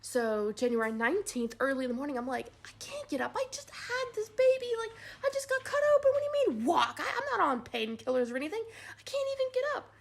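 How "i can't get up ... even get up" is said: high, laughing, whiny complaint; whiny protest. Retold or imitated. New mother begging nurses not to walk, high whiny and laughing. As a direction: plead half-laughing, half-crying.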